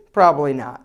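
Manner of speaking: normal talking voice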